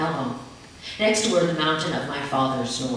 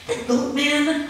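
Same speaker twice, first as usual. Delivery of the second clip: put-on slow, higher, frightened child; agitated whisper; 9 semitones higher, slower than usual. A narrator's reading. Frightened child telling mother about the goat man, slow scared voice. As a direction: drag it out, scared. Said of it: "I whisper, agitated".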